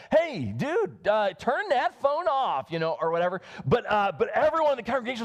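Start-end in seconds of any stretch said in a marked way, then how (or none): 0.1-2.7 mock scolding.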